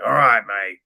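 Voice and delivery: loud New Zealand "mate"; thick New Zealand accent. Retold or imitated. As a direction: imitate a New Zealander giving in. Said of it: "New, New Zealand accent".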